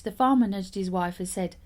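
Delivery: ordinary delivery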